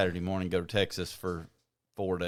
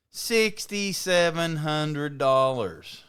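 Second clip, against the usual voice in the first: slow, loud, higher-pitched price announcement; incredulous emphasis; 6 semitones higher, 5 dB louder, much slower than usual. Real, not acted. Telling co-host the one-way truck price, slow incredulous emphasis. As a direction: draw out every word of the outrageous figure. Stressing a shocking rental price.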